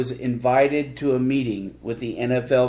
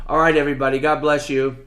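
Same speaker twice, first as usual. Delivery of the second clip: ordinary talk, nothing marked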